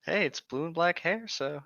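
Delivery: ordinary talk, nothing marked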